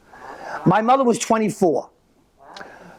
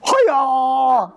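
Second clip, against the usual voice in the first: karate yell; 7 semitones higher than usual. Acted.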